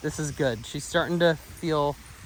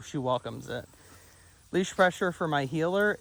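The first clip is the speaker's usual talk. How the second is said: normal talking voice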